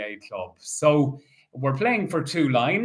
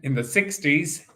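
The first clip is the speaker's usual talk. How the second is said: bingo caller. Acted. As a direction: call each ball out steadily. Calls bingo numbers.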